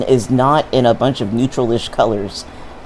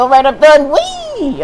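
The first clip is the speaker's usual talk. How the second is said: a casual line, then a much higher squeal of glee; gleeful jump; 13 semitones higher, 4 dB louder, slower than usual. Pretend. Imagined player jumping off the balcony, giddy squeal. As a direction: leap off the balcony with a giddy whee. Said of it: "Just be like".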